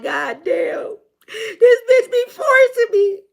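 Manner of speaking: high, loud, drawn-out whine; hammed-up crying complaint, slowly; mock wail. Reader voicing a partner who feels forced, mock wailing. Voices someone feeling forced.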